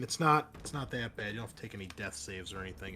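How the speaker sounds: ordinary delivery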